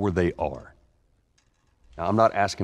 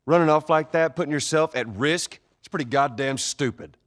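angry scolding; 7 semitones higher than usual. Acted. Scolding the girl who ran.

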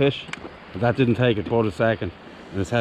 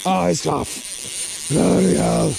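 dismayed outburst; 8 semitones higher, much slower than usual. Real, not acted. Fish gets away.

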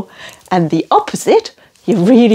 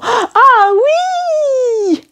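eager French yes. Shown as a demonstration.

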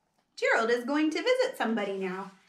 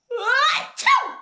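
high, loud acted sneeze; big fake sneeze; 14 semitones higher, 7 dB louder than usual. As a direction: burst out in a stagey sneeze. Elephant character sneezes in story.

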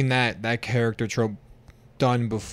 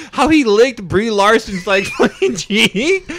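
high, loud and slow through laughter; laughing disbelief; 8 semitones higher, 5 dB louder, slower than usual. Real, not acted. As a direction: laugh through the words, drawing them out. Laughs while recalling a weird scene.

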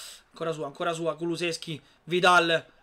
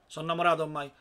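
ordinary talk

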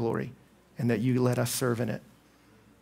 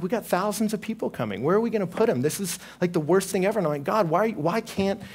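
higher, whiny mock protest at God; mock complaining; 8 semitones higher, 4 dB louder than usual. Preacher retelling his complaint about losing the arena, mock whiny. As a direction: play up the old frustration, half-joking.

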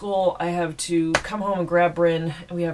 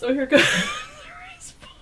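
usual speaking voice

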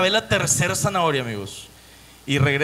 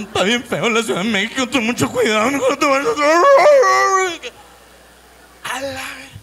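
higher, whiny worried mom, like Scooby-Doo; 6 semitones higher, 4 dB louder than usual. Retold or imitated. Comic imitating his worried mother warning him about Mexico City, Scooby-Doo voice. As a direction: wail the warnings like a scared cartoon dog. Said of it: "empieza a hablar como Scooby-Doo".

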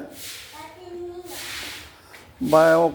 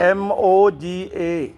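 spelling it out slowly; much slower than usual. Shown as a demonstration. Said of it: "ਮੋਗੋ ਕਿ ਮੋਗਾ?".

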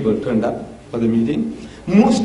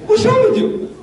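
much higher, laughing mock exasperation; laughing mock scold. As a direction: throw it up high with a laugh, half-teasing. Mock scolding fellow Arabs.

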